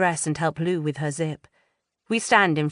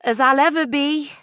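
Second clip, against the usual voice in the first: put-on much higher, louder teen voice; high plucky teen voice; nervous bravado; 10 semitones higher, 6 dB louder than usual. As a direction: brave it out with a grin. Narrator's teen self answering.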